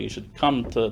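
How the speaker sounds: ordinary talk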